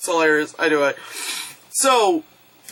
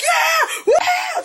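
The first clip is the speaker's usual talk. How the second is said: very high cheering yells; imitating an ecstatic cinema crowd; audience freaking out. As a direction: whoop like a crowd going wild. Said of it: "the audience is just freaking out".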